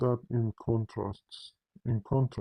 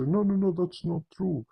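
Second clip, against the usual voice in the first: higher, hurried denial; mock protest; 6 semitones higher than usual. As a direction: imitate an insistent objector. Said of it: "they'll say".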